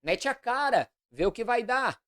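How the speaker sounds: derisive, dismissive encouragement; mocking tone. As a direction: goad the king with sarcastic go-aheads. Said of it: "em tom de deboche".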